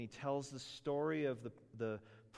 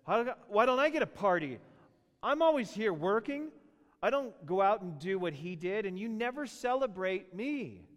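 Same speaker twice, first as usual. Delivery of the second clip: higher, louder aggrieved complaint; resentful older brother; 7 semitones higher, 7 dB louder than usual. Retold or imitated. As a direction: protest like a jealous son. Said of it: "the older brother said".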